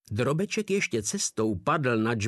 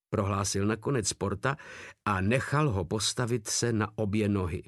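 usual speaking voice

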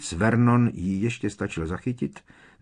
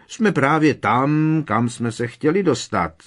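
sarcastic; 6 semitones higher, 5 dB louder than usual. A narrator's reading. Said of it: "velice uštěpačně".

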